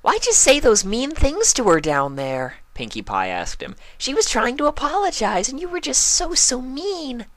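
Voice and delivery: very high, hurt pony voice; Pinkie scolding. A narrator's reading. Pinkie chiding Spike, very high and hurt. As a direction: chide him sadly.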